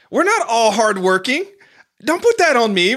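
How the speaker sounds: high, loud, exasperated protest; frustrated comedian. Retold or imitated. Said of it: "He was basically frustrated".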